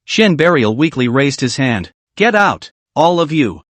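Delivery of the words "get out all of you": usual speaking voice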